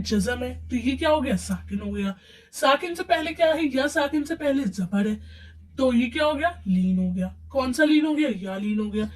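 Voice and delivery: usual speaking voice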